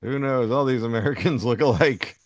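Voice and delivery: usual speaking voice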